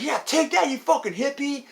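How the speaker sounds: high, loud heckle at the screen; gleeful jeering. Retold or imitated. Moviegoer heckling the beaten hippie, gleeful jeer. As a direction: shout it like a fired-up fan.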